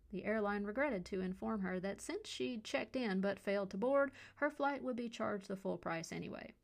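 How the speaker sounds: plain reading voice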